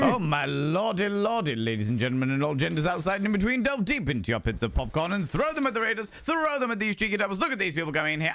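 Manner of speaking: high, theatrical announcer cry; rally the audience like a showman; hammed-up raid welcome.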